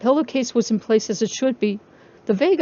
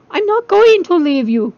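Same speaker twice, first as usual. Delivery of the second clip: higher and louder desperate promise; crying out; 8 semitones higher, 8 dB louder than usual. Acted. Charlie shouting to the wailing toys, desperate. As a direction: call out to reassure the crying babies. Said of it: "Charlie cried".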